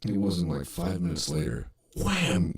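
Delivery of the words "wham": sudden loud, high slam word; imitated crash. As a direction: punch it out like a body hitting lockers.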